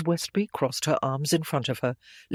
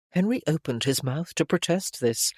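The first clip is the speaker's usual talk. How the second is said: usual speaking voice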